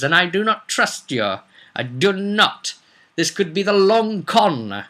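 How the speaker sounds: eye every gift as a trap; hammed-up paranoid player character; paranoid adventurer. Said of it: "They're suspicious about everything".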